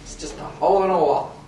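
loud joking. Real, not acted. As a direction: shout the joke over the table. Joking over DM's description.

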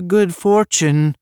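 slow, high, loud send-off; shout back. A narrator's reading. Brothers wishing Lek good fortune, shouted back. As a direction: call it out slowly across a distance. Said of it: "his brothers shouted back".

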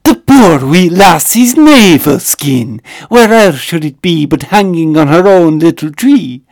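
loud, slow, booming theatrical delivery; grandly overacted, savouring every word; hammy villain voice. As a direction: ham it up like a horror film lord relishing the line. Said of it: "And he goes".

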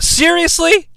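high rising disbelief on seriously; stunned question; incredulous. Real, not acted. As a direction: ask it like you can't believe it. Reacting to a dull lockup.

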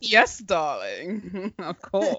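put-on very slow, affected British accent; hammed-up British endearment. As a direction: draw it out in mock-British. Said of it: "supposed to be a British accent".

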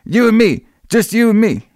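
high-pitched retold outburst; pissed off. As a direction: imitate a riled-up guy in a high voice. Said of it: "he gets all pissed off".

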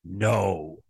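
low, measured "No"; firm refusal. Shown as a demonstration. Host shows commissioners how to say the word no, low firm refusal. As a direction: drop the pitch way down on a single firm "No".

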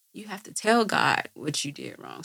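normal talking voice

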